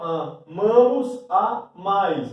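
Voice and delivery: loud, drawn-out "amamos, amais", stressed aMAmos, aMAIS; stress on the ending. Shown as a demonstration. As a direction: punch the endings slowly: a-MA-mos, a-MAIS. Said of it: "mas tá na desinência".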